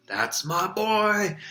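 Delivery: high, slow, jubilant yell. A narrator's reading. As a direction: cheer him from below. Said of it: "Wood kept yelling".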